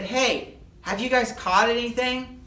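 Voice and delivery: high, loud friendly hail across the water; calling from shore. Acted. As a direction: call out to a distant boat.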